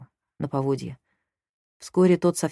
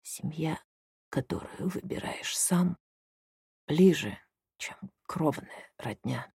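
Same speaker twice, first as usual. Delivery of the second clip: slow whisper broken by gasps; halting whisper; 4 dB quieter, slower than usual. Acted. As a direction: gasp for air between words. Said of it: "раненый шептал с большими перерывами, хватая ртом воздух".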